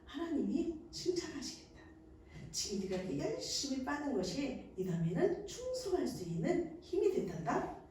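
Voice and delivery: put-on soft, hushed mother talking to her baby; a mother praising her nursing baby softly; tender words to a nursing baby. Shown as a demonstration. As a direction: coo gently to the infant at the breast. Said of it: "이렇게 이야기를 해주면서".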